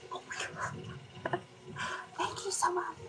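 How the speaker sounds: soft whispered thanks; whispering. Real, not acted. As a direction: whisper it, trying to stay quiet. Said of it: "I'm trying to be quiet".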